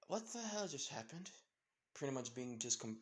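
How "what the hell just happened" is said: high-pitched bewildered question; acted bafflement, voice raised in pitch; utter confusion. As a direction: ask it pitched up, completely lost. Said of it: "utterly confused".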